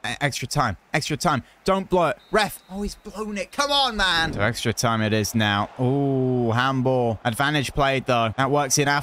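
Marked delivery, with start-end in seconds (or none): none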